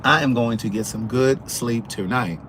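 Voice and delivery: every word spelled out, "going to" in full; formal, uncontracted diction with a crisp "tonight"; careful full enunciation. Shown as a demonstration. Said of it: "When I say I'm gonna get".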